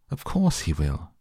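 ordinary delivery

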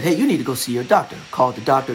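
usual speaking voice

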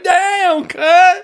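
very high, loud mock shout; mock shouted cry. Mimicking the reporter calling for a cut, high shout. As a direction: yell it like a cameraman in distress. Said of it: "I'm like".

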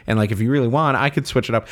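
ordinary talk, nothing marked

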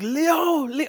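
high-pitched imitated cry of a name; mock dying cry. Retelling the burning man calling for Leo, mock dying cry. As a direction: call it out high, as a retold movie moment.

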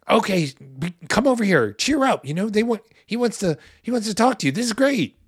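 high, loud, eager cheering; fake crowd cheer. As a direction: gush as if it were their idea all along. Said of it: "And then they're saying".

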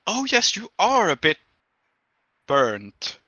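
high lunch lady voice, dropping at the end; lunch lady inspecting. Acted. Lunch lady examining the burnt back, high and appraising. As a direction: look over the damage, pause before the last word. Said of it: "and goes like".

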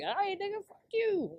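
high-pitched mock outrage; indignant comeback. Retelling an angry comeback to hang-up.

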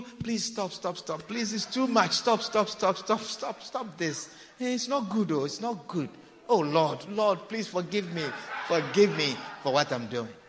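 mock pleading sinner. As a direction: beg them to stop, half-hearted. Mimicking sinner in the act.

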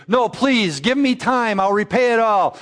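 high, desperate begging; pleading debtor. Acted. Preacher acting the choked debtor begging for time, desperately.

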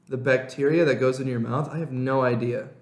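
usual speaking voice

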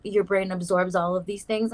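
usual speaking voice

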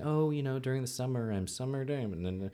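lilting mock small talk from a school teacher; chatty teacher. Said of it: "one of the teachers was saying".

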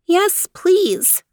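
put-on high eager child voice; little girl Leanie. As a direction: pitch it up like a small girl. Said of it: "replied Leanie".

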